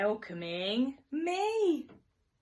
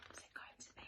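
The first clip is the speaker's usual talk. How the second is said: barely audible whisper; 22 dB quieter, faster than usual. Acted. Teacher to children, suspenseful whisper.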